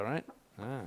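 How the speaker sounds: ordinary talk, nothing marked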